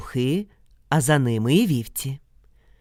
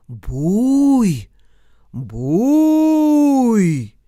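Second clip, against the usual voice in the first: loud long howls, second higher; close creature call; 6 semitones higher, 5 dB louder than usual. Acted. Ogre calling out close to the frightened brothers, howled. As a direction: bellow it now right beside them. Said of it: "голос почувся вже зовсім близько".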